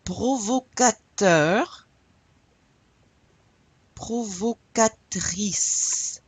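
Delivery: Provocateur and Provocatrice said very slowly and loudly; slow model. Shown as a demonstration. Teacher slowly modelling words for the class to repeat. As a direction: spell out each syllable slowly for the class. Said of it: "Say that again with me".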